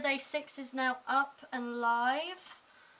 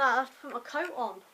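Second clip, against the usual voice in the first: ordinary delivery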